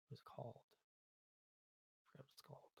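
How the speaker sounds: very quiet, near-whispered mutter; muttering to self. Real, not acted. Developer forgetting a tool's name, muttering to self. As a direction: mumble it under your breath.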